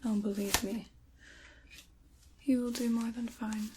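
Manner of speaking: normal talking voice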